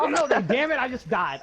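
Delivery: high, loud startled outburst; sudden alarmed exclamation; real dismay. Real, not acted. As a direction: blurt it as the game kills you. Reacts to dying in game.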